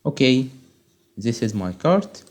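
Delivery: normal talking voice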